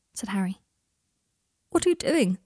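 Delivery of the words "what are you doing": startled, high-pitched question. A narrator's reading. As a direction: jump up high and louder, taken aback. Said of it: "in a high voice".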